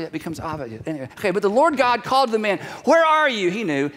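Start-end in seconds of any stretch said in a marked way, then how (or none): 2.9-3.5 raised, higher-pitched call; God calling out. Acted. God calls to the hiding man, calling out.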